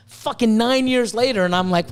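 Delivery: ordinary delivery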